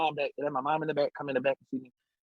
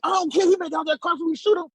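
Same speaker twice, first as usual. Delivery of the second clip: high, loud imitation of police; heated mimicked command; retold shouted threat; 13 semitones higher, 5 dB louder than usual. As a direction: yell it as an officer overruling protest.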